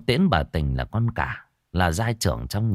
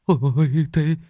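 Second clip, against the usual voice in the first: stammering, rising higher and louder in panic; frightened stammer; 8 semitones higher, 4 dB louder than usual. A narrator's reading. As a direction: stutter out the fear for a dying father. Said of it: "miệng run run". (lắp bắp hoảng sợ, giọng càng lúc càng cao)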